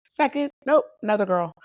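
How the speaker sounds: spring the twist on them; surprised reveal. Real, not acted.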